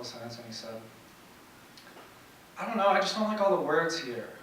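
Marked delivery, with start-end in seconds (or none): 2.6-4.3 lightly voiced boy airing his complaints; a touch of a kid's indignant list of dislikes; boy's rant. Acting out boy's rant about words.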